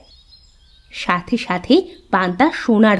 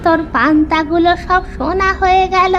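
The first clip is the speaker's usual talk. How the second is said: higher, slow mystical crone voice; mystical old woman; 7 semitones higher than usual. Acted. Old woman tells the boy not to fear, mystical. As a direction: soothe him like a magic granny.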